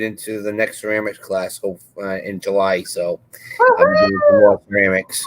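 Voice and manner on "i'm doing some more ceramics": excited squeal. Real, not acted. Excited news of ceramics class.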